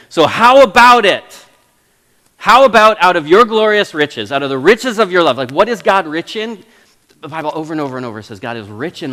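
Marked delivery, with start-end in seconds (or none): 0.1-5.4 bold challenge to God. Retold or imitated. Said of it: "he says".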